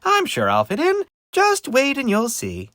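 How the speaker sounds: high, loud, hopeful character voice; cheery little pumpkin. Acted. Christopher promising the witch he'll fit in, high optimism. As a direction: chirp it like an eager small pumpkin.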